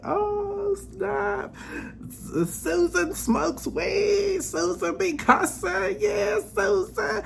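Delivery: very high, slow, squealing disbelief; shocked gossip. Retold or imitated. Classmate squealing in shock at the nerd Susan. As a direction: squeal it like you can't believe it. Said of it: "and you like".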